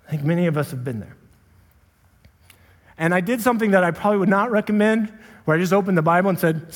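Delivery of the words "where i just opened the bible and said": ordinary talk, nothing marked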